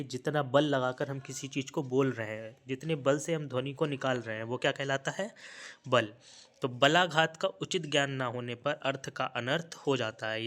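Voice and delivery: ordinary talk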